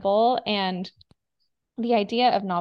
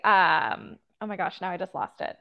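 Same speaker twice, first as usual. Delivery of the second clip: usual speaking voice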